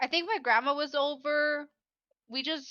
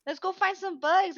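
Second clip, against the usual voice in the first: lightly voiced kids, pitched up; higher, keen child calling to the teacher; children's eager call; 6 semitones higher, 3 dB louder than usual. Teacher retells pupils wanting a bug hunt, mildly mimicked kids. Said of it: "they're like".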